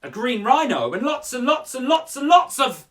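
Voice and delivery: lively, rising read-aloud with building screams; expressive poem reading. Acted. Presenter reading a child's poem to kids, building to screaming. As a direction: read the poem with growing drama. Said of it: "It goes like this".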